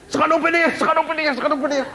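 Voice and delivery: excited relayed report. Acted. Dwarfs relay keyhole news in turn.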